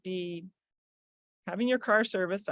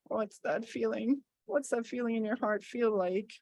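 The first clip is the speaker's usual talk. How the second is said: softer, slowed sorrow; demo sad; 5 dB quieter, slower than usual. Shown as a demonstration. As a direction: drop into quiet sadness. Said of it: "And sad".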